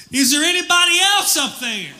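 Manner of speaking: high-pitched mock yell. Jogger yells upward for anyone else, high mock yell. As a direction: call past God in a high, strained shout. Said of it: "he yells out".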